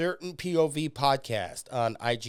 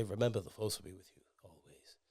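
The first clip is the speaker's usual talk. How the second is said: whispered Obi-Wan line, breathy and hushed; ghostly whisper; 4 semitones lower, 10 dB quieter than usual. Retold or imitated. Obi-Wan's ghost speaking to Luke, in a whisper. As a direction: breathe it out like a spirit's voice. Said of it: "Obi-Wan say".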